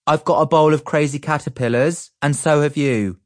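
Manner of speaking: patient big-brother tone; older brother voice. A narrator's reading. Big brother reasons with his little brother. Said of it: "I said in my older brother voice".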